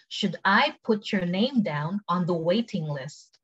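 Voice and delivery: very slow, word by word; slow, careful model question; slow take. Shown as a demonstration. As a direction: draw it out for learners to follow. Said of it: "cepet sama pelan".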